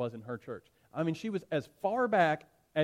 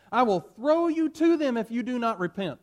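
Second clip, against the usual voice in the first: much higher and louder menacing yell; threatening shout; 8 semitones higher, 8 dB louder than usual. Acted. Proconsul threatening Polycarp in the arena, shouting. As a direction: bellow the threat at the prisoner. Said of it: "the proconsul said".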